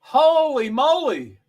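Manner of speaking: loud, high, drawn-out astonishment; amazed exclamation. Real, not acted. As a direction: stretch it out loudly in amazement. Amazed reaction to race standings.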